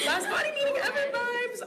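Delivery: high, wet laugh breaking through tears; last meeting, laughing through tears; teary laugh. Real, not acted. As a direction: laugh it off while choking up.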